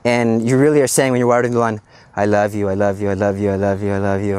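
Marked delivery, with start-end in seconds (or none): 2.2-4.4 dreamy lovestruck. Retold or imitated. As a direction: sigh it like a smitten teen. Smitten teen repeating love.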